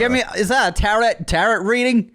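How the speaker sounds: put-on high-pitched southern accent. Co-host joking about tarot reading, southern accent. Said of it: "What's with the southern accent?".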